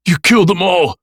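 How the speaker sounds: loud, high, choked with anger; furious tearful shout. A narrator's reading. Nick accusing the killer of his friends, furious. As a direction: yell it through angry tears. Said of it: "with furious tears of anger".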